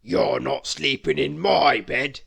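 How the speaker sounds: loud, booming bear; loud booming warning; booming big bear. A narrator's reading. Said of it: "boomed the big bear".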